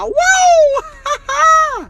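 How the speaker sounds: triumphant high woo; whoop. Real, not acted. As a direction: cheer yourself on. Challenge bravado.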